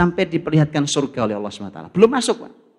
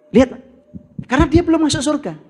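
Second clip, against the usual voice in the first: raised, fast urging; emphatic call; 10 semitones higher, 3 dB louder, faster than usual. Real, not acted. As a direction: push the point home loud and quick. Preacher urging the audience to look.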